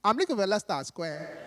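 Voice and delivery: polite Nigerian tourist asking the way; imitated Nigerian English; Nigerian accent. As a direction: plead in a put-on Nigerian accent. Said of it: "this Nigerian tourist".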